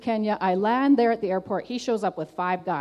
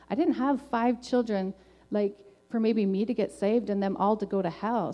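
ordinary talk, nothing marked

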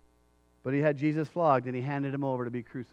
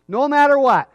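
loud, raised resolute vow; emphatic declaration; 8 semitones higher, 11 dB louder than usual. Acted. Believer pledging allegiance to Jesus, emphatic declaration. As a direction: proclaim it forcefully. Preacher voices a bold declaration.

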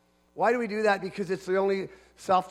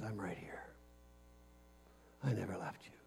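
soft, intimate whisper, much softer; whispered voice of God; 10 semitones lower, 19 dB quieter than usual. Acted. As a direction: breathe the promise close to the ear. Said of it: "Hear his voice today when he says".

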